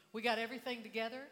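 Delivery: normal talking voice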